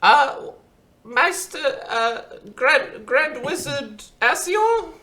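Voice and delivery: Bushel calling out. Acted. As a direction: clear the throat, then call the wizard. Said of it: "Bushel kind of coughs and".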